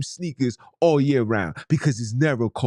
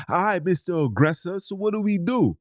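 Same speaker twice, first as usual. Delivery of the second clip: mock eager follower; 7 semitones higher than usual. Mocking quote of eager follower.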